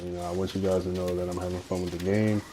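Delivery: ordinary talk, nothing marked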